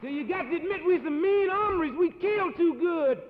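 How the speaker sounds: hammed-up cowboy bravado; loud brag. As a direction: shout the bragging up high. Cowboy brags loudly.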